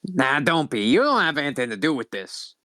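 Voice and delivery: raspy-voiced alien leader; Lady Vera. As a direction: rasp out a dismissive reassurance. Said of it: "really raspy voice".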